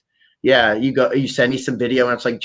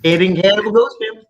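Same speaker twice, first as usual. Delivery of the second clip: mock title; 8 semitones higher, 4 dB louder than usual. Joking title riff.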